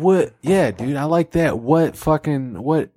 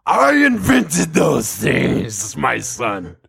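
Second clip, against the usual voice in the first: booming God voice; much slower than usual. Acted. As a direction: boom it slowly from above. God shouts slowly.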